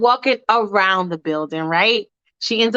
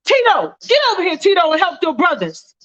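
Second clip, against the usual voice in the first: much higher yelling call across the alley; yelling father; 8 semitones higher than usual. Acted. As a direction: holler for the son to come help. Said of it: "she hears a man yelling".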